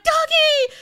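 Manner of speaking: very high, loud mock shriek of delight; one big high-pitched gushing cry; super excited squeal. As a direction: imitate someone shrieking happily at a dog. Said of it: "she just got super excited".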